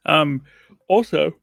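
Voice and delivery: talking through a yawn; words stretched by a real yawn. Real, not acted. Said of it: "he's yawning already".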